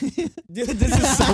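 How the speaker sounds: high, loud laughing through the words; cracking up at how Canadian it is. Real, not acted. Friend laughing at the moose behind them, laughing.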